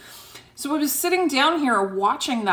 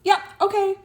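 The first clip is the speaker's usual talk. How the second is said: mock agreement; 10 semitones higher than usual. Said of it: "And I'm like".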